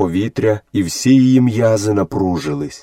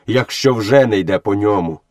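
usual speaking voice

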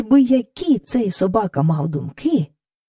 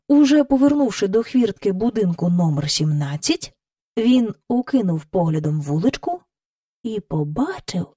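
ordinary talk, nothing marked

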